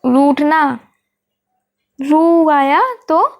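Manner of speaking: रूठना with the रू stretched slowly; drawn-out long ru. Shown as a demonstration. Teacher stretching रू to show the long vowel. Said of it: "बड़ा ऊ".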